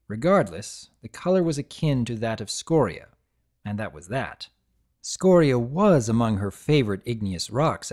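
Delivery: ordinary delivery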